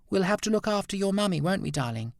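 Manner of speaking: put-on high, quick, sing-song to a baby; baby talk. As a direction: coo it to the little one. Talking to the baby.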